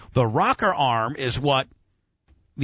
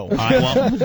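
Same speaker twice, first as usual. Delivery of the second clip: laughing; 9 semitones higher, 5 dB louder than usual. Real, not acted. Laughing after Brett answers.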